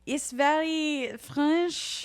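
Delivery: slow, high hammed-up French accent. Host explaining her put-on French accent to her sister. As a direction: drawl it in a mock French accent. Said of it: "The way I speak is Frenchy".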